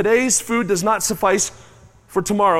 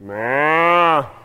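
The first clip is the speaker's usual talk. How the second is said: contented cow sigh; 5 semitones lower than usual. Retold or imitated.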